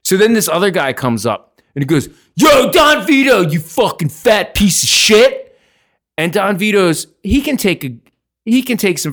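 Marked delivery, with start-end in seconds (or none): 2.3-5.6 loud, high bar-room insult; acted-out belligerent yell; mock heckling shout. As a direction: imitate a heckler hollering across the bar. Drunk heckler insulting Don Vito.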